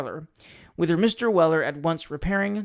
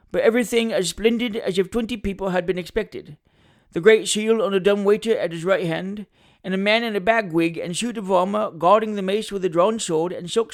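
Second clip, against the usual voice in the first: boastful storyteller in character voice; pompous attorney reminiscing; 3 semitones higher, 4 dB louder than usual. Acted. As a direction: lawyer proudly recalling grand company. Attorney tells a boastful anecdote.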